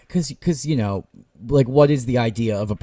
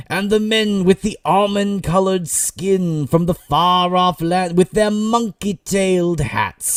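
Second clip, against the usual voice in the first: higher, slow grandiose parody of the prose; mock storybook reading; 6 semitones higher, slower than usual. Podcaster mocking author George's exotic prose, slow and higher. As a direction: read it like an overblown fantasy tale. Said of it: "sometimes it's like".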